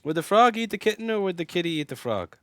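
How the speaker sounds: high, loud playful question; excited. Real, not acted. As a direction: ask it with gleeful mischief.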